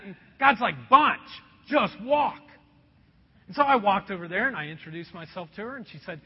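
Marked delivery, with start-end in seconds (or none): 0.9-2.4 loud, curt order from God; firm command. Retold or imitated. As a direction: cut off the excuses with a punched command. God's firm order, retold.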